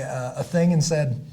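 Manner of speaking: ordinary delivery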